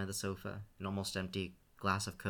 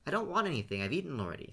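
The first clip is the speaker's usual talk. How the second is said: clipped, impatient brush-off, pitched up; 6 semitones higher, faster than usual. A narrator's reading. Scene impatiently refuses food from his brother. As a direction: cut him off, you're fed up. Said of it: "Scene answered impatiently".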